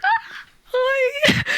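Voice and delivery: hammed-up shy fangirl greeting; starstruck squeak. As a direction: squeak a nervous hello to your hero.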